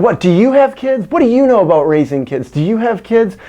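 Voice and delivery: high, loud, changed voice challenging; angry subway woman. Retold or imitated. Woman on the subway challenging the speaker, high loud mock scolding. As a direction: jab the questions high and loud. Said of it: "one of them said".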